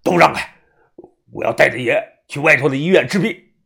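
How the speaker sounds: loud, forceful threat; menacing demand. Acted. Follower set on taking his master to hospital, menacing. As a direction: order everyone out of the way. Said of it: "一脸的杀气腾腾".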